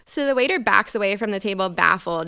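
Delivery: ordinary talk, nothing marked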